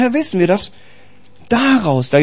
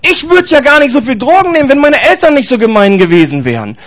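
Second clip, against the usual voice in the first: mock whiny excuse; 6 semitones higher than usual. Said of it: "Der X Y sagt".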